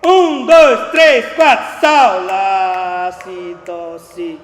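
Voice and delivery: attack the phrase at full volume; loud start. Shown as a demonstration. Teacher demonstrating starting loud, too forceful. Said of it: "Se eu inicio assim".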